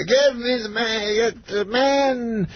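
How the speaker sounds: high, very slow, halting mimicry; Bill Cosby. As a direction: stretch each word in a high impression. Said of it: "And he goes".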